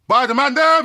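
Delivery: high, loud catchphrase; catchphrase shout. Acted. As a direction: belt out the catchphrase. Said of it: "as we always say".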